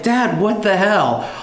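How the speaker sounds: high, drawn-out mock exasperation; indignant protest. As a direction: retell a son's disbelieving complaint, slowly. Acts out his protest to dad.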